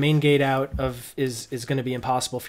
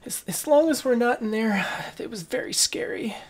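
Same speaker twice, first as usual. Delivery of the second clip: put-on child voice; 10 semitones higher than usual.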